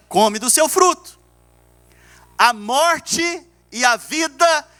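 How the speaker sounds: high, measured preaching with held pauses; declaimed scripture. Real, not acted. Pastor proclaiming Proverbs to the church, declaimed scripture. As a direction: proclaim the verse phrase by phrase.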